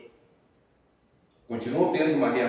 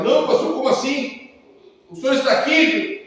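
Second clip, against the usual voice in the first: shocked protest; 12 semitones higher, 9 dB louder than usual. Retold or imitated. Shocked wife, pastor repeats order.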